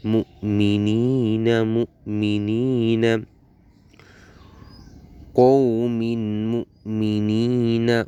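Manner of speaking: slow, measured reciting with stretched vowels; dua recitation. Shown as a demonstration. Teacher models the joined phrase for learners, slow recitation. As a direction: join the syllables into a drawn-out recitation.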